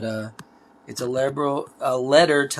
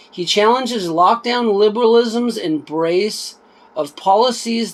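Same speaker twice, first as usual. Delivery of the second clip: reading a blurb aloud, a bit livelier than chat; book read aloud; 5 semitones higher, 5 dB louder, slower than usual. Acted. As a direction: read the back cover out to viewers. Reads back cover aloud.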